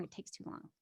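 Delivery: whispered complaint. Retold or imitated. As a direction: fade it to a whisper. Whispered final repeat.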